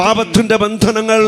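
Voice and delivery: high, loud declaratory cry; full-force prayer. Real, not acted. Preacher leads congregation line by line, loud fervent proclamation. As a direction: call it out strongly for the crowd to echo.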